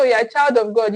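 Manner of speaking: normal talking voice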